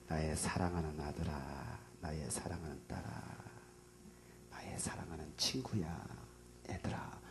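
cherish every name as you call; Jesus tenderly calling his children; loving call. Acted. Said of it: "이렇게 부르신다니까".

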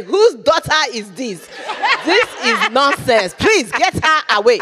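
put-on loud, high, outraged African accent; rude African heckler. African audience member rejecting the comic, outraged African accent. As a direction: scold in a hammed-up African accent. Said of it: "this is gonna be in front of Africans, and they are the rudest people".

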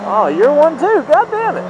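high, loud frustrated cry; exasperated outburst. Real, not acted. Rider fed up with another turning driver, exasperated. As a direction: blow up at the turning car.

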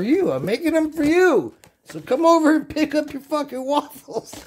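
squeaky joking voice for the viewers; goofy high voice. Real, not acted. Drunk silly high voice.

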